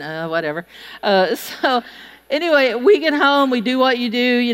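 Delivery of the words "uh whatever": dismissive grunt. Retold or imitated. Dismissive men coworkers.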